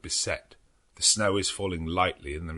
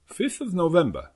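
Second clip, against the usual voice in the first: put-on the date announced higher and louder as the old doctor; Van Helsing voice; 13 semitones higher, 8 dB louder than usual. A narrator's reading. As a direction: open his memorandum with a firm raised voice. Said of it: "Doctor Van Helsing's memorandum".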